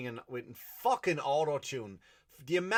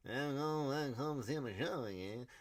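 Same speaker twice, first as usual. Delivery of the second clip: wavering, slurred singer's mumble; 2 semitones higher than usual. Acted. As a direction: parody a rambling, wobbly half-sung vocal.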